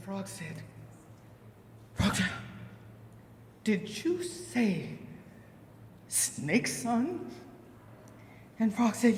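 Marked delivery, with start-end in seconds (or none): 2.0-7.3 slow, quiet, stunned mother; hushed dismay. Acted. Mama Frog questions her child about Snake Son, hushed. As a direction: let each phrase hang in hushed disbelief. Mother shocked by friend's name.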